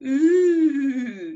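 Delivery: loud, drawn-out ghostly "ooh"; haunt them with a long "ooh"; wee ghost. Shown as a demonstration. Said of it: "That's your ghost".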